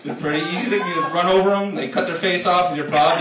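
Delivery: usual speaking voice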